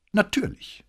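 very high, proud affirmation; undisguised pride. A narrator's reading. Cadet confirming his school is costly, openly proud. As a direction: lift "Natürlich" high with open pride. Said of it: "mit unverhohlenem Stolz".

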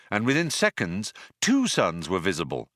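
plain reading voice

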